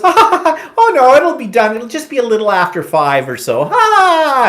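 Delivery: loud, high, hammed-up fake laughter; mock receptionist laugh. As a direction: imitate her laughing off the delay at full volume. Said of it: "certain tones of voice trigger me".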